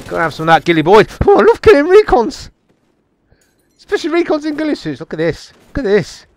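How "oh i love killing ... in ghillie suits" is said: high-pitched, excited crowing; gleeful gloating. Real, not acted. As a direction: crow over your favourite kill. Gloating after a kill.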